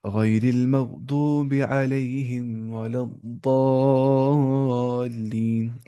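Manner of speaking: very slow melodic recitation; Quran recitation. Shown as a demonstration. Teacher reciting the verse to show the ज़ाद, slow chant. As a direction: chant it slowly like tilawat. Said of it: "ना पढ़ना है दाद".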